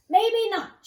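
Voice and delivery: loud, high hopeful shout. A narrator's reading. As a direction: call out the good news. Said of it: "shouted Toot".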